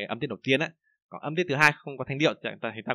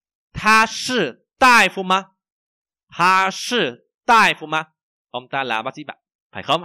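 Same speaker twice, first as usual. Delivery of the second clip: very slow, loud, word by word; language teacher's model reading; 5 semitones higher, 8 dB louder, much slower than usual. Shown as a demonstration. Teacher reads for learners to repeat, slow model reading. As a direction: exaggerate each tone, high and clearly spaced.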